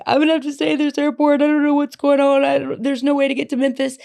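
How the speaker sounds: high, fast, panicked pleading to mom; frantic teen on the payphone; upset crying call. Retold or imitated. Teen calling mom from stranded airport, panicked. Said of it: "I'm, like, crying".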